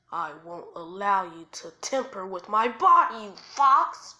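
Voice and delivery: rising outburst at a foe; defiant. Acted. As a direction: shout it defiantly, peaking on the last words. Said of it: "Oops, I said that wrong".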